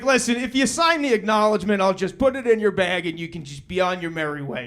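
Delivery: fast, businesslike brush-off; brisk nurse. Retold or imitated. Nurse hurrying the new dad through paperwork, fast. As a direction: rattle through it to get rid of him.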